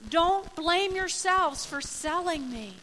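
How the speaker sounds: high, loud, drawn-out appeal; Joseph reassuring his brothers. Acted. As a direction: plead it slowly and emphatically to your brothers. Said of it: "And Joseph goes".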